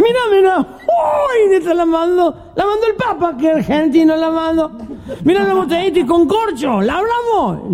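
put-on very high, excited drunk squeal. Drunk spots a floating bottle, very high and excited.

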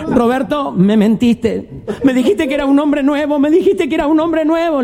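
high whiny wife's complaint; reproaching wife. Acted. Wife reproaches Roberto for lying, high and whiny. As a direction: whine like a betrayed wife.

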